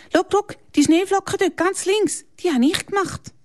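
put-on high, bright child's voice pointing something out; a proud girl showing off her snowflakes; proud little girl. A narrator's reading. Chiara zeigt stolz auf ihre Schneeflocken, hohe Kinderstimme. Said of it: "Chiara zeigt nach oben".

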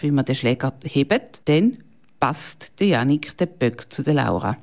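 ordinary delivery

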